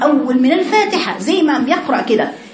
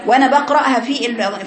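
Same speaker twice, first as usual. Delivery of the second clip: normal talking voice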